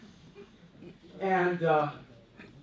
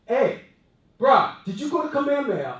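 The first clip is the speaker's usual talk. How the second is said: calling out; 7 semitones higher, 8 dB louder than usual. Retold or imitated. Retold stranger calling out.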